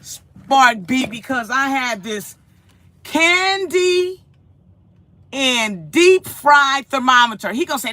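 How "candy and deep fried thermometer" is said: usual speaking voice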